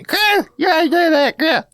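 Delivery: high, slow, shouted mom voice; mom yelling. Retold or imitated. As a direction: holler it like a mother scolding her grown daughter, way up high. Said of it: "my mom's yelling at me".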